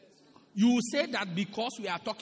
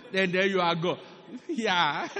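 ordinary talk, nothing marked

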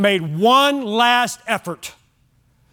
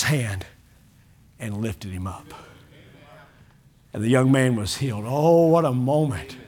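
hushed tender storytelling; 10 semitones lower than usual. Acted. Preacher retelling how Jesus lifted the boy, hushed and tender. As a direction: let the wonder sink in gently.

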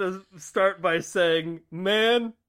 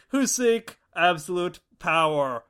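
usual speaking voice

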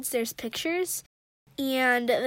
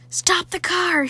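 shouted; 5 semitones higher, 6 dB louder than usual. Acted. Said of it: "she shouted".

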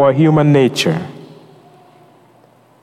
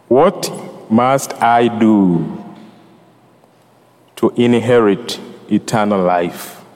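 slow quoted question; 3 semitones lower, slower than usual. Retold or imitated. Quoting the gospel question slowly.

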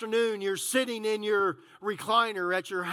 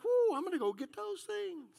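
excited whoo; 7 semitones higher, 7 dB quieter than usual. Retold or imitated. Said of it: "I'm like".

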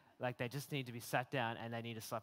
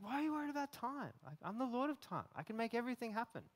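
higher, urgent rebuke about time; exasperated Jesus; 6 semitones higher than usual. Retold or imitated. Jesus rebuking a busy, worried listener, higher urgent voice. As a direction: press every question home, lovingly impatient. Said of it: "He's like".

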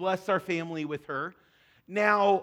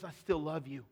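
low and very soft; tender reassurance; 4 semitones lower, 9 dB quieter than usual. Acted. God as father wrapping arms around us, softly tender. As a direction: comfort like a loving father.

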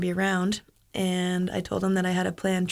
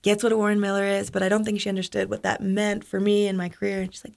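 usual speaking voice

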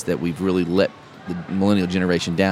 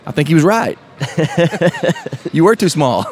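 mom laughing; 6 semitones higher, 5 dB louder than usual. Retold or imitated.

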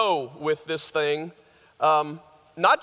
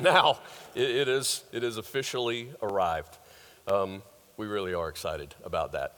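normal talking voice

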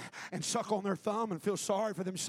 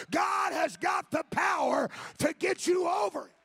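loud, rising preacher's call; fervent pulpit shouting; preaching at full force; 3 dB louder than usual. Acted. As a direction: preach it out to the congregation.

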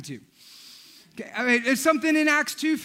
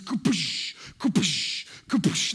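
splash sound effect; 5 semitones lower, slower than usual. Retold or imitated. Baptism dunking sound effect.

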